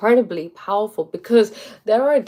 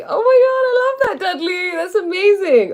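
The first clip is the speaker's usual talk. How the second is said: higher and louder, bursting with joy; delighted gush; 12 semitones higher, 5 dB louder than usual. Real, not acted. To Dudley about his progress, gushing with delight. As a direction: squeal over a friend's great news. Reacting to Dudley's good news.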